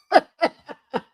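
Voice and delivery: high burst of laughter; amused cackle. Real, not acted. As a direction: crack up laughing.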